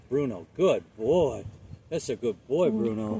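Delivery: slow, bright praise for the dog; happy dog praise. Shown as a demonstration. Trainer showing the owner how to praise the dog, slow and happy. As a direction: lay the praise on thick for Bruno. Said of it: "now he's gonna hear nothing but".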